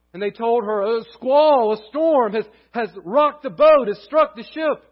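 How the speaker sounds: loud, high storytelling voice; building pulpit intensity; dramatic preaching. Acted. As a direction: drive the storm story home from the pulpit.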